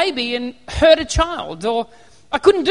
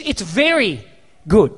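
"very" lowered and leaned on; stress on very; 7 semitones lower, slower than usual. Retold or imitated. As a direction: savour "very". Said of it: "he says".

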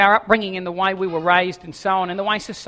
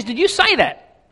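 startled burglar; 6 semitones higher, 3 dB louder, slower than usual. Acted. Burglar questions parrot.

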